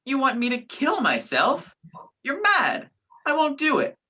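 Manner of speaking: high, agitated protest; rising outrage at a deadly suggestion; alarmed refusal. Acted. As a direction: recoil in disbelief, pitch leaping up. Told to shoot himself, refuses.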